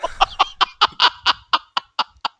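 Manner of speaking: high, airy cackle in rapid bursts; out-of-control sadistic laugh. Acted. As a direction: let a maniac's cackle run on. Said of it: "you've got this laugh that's just out of control".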